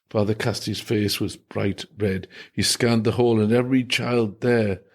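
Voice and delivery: plain reading voice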